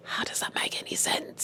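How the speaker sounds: laughing whispered aside. Real, not acted. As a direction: breathe it out in disbelief. Aside to viewers.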